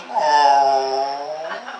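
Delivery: low mock dog whine; lightly voiced lab whining over the bird; dog's whimper. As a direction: imitate a sad dog's moan. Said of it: "looks up at Doctor Mallory and goes".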